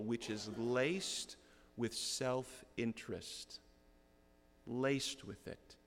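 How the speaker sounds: slow, leaning on "laced"; stress on laced. Real, not acted. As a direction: draw out "laced with" slowly. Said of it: "it's like dripping off the page".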